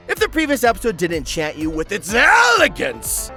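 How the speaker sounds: normal talking voice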